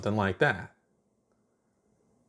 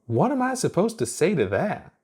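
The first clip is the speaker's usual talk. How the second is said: put-on high, flustered girl voice; 9 semitones higher than usual. A narrator's reading. Flustered Chuck.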